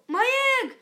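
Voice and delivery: high, loud joyful shout. A narrator's reading. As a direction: cry out over the hatching egg. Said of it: "shouted Horton".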